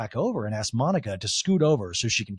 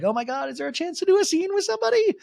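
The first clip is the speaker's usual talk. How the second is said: very high desperate squeal; Angie impression; 3 dB louder, faster than usual. Castmate begging someone for a scene, high mock desperation. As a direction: plead in a hammed-up, pitched-up voice. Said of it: "Angie's like".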